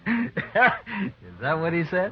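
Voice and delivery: slow, amused question through a laugh. Acted. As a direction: chuckle the words out slowly. Amused at accusation.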